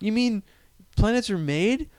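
high, amazed disbelief; incredulous question. Acted. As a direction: ask it wide-eyed and astonished. Acted incredulous alien.

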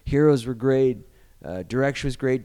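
ordinary delivery

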